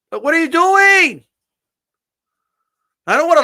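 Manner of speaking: exasperated outburst. Real, not acted. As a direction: yell at the screen in disbelief. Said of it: "repeats his outburst".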